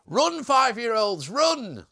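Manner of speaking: high, loud cry with drawn-out words; mock shout. Streamer jokingly warns children to run, mock shouting. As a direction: yell a joking warning to flee.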